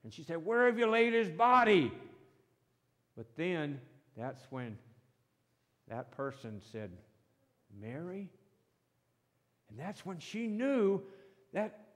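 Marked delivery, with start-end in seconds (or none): 0.4-1.9 loud, high-pitched plea; acted distraught outcry; urgent demand. Mary demands body from supposed gardener. 7.8-8.3 soft, questioning name with a rise; quiet, acted call; gentle calling. Risen Jesus gently calls Mary's name.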